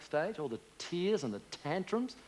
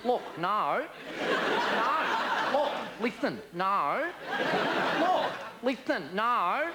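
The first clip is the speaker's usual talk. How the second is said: Aussie loop; 6 semitones higher, slower than usual. Retold or imitated. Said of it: "we go".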